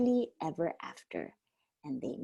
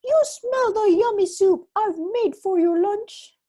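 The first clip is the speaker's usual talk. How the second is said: giant's wife; 11 semitones higher, 8 dB louder than usual. A narrator's reading. Wife covers for Jack.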